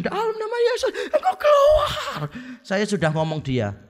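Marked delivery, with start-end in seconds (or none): 0.0-2.2 very high squealed command, last word stretched; hammed-up falsetto imitation of a screaming exorcist; mock exorcist scream. Friend commanding the demon harder, mock high-pitched scream. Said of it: "tambah kencang".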